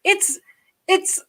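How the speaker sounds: high, insistent, panicky protest; frantic. Retold or imitated. Arguing with dad on the phone, frantic and high. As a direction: stutter it out in rising alarm. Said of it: "I go".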